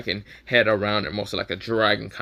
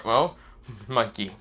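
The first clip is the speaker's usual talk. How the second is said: usual speaking voice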